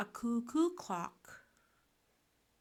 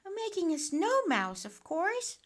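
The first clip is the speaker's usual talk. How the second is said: put-on high, loud, quick little boy; matter-of-fact William; 11 semitones higher, 5 dB louder than usual. A narrator's reading. Boy explaining his game to friends, self-assured. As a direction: pipe up as if it's obvious. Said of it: "said William".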